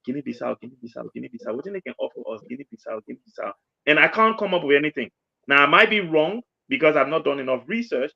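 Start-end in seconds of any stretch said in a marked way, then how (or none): 0.0-3.5 quiet musing under the breath, softer; thinking aloud. Retold or imitated. Pundit searching memory on air, muttering softly to himself. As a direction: mutter it to yourself while searching for an answer. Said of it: "trying to run through my head".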